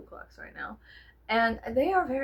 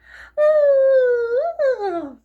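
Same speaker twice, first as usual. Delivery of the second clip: big sigh; 14 dB louder than usual. Real, not acted. Frustrated over dry cleaning.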